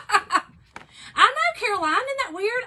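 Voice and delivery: high, loud and laughing; excited laughing reply. Real, not acted. Laughing loudly back at Caroline's comment, excited. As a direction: burst out answering the comment.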